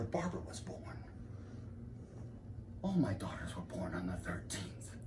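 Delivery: soft, halting, with long pauses; gloomy reminiscence. Acted. Lecturer brooding on failed life and thirteens, hushed gloom. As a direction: sink slowly into hushed despair.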